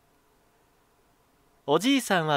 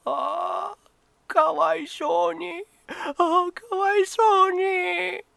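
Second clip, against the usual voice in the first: much higher, slow crying lament; old man crying over his ruined garden, much higher and slow; weeping old man; 3 dB louder, slower than usual. Acted. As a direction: sob over the broken flowers. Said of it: "ポロポロと涙をこぼしました".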